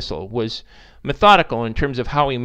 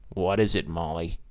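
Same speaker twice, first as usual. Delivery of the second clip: unhappy bar owner; 7 semitones lower, 5 dB quieter than usual. Acted. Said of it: "came in but wasn't happy".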